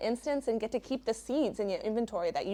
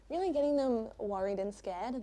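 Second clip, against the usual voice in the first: ordinary talk, nothing marked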